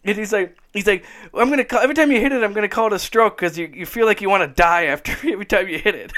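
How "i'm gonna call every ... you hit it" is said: high and fast, laughing through the punchline; hammed-up excited inventor, breaking into a laugh; excited comic bit. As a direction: rush the joke in a giddy higher voice. Retelling comic's golf inventor.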